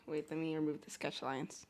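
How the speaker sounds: normal talking voice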